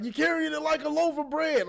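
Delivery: mock coach voice; imitating a coach. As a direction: bark it like a coach on the sideline. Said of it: "when the coaches be like".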